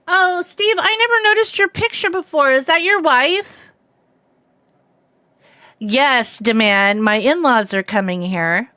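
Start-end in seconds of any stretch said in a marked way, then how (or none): 0.1-3.7 gushing surprise. Real, not acted. Gushing at viewer's picture.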